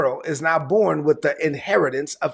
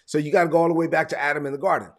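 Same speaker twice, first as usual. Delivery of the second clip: ordinary talk, nothing marked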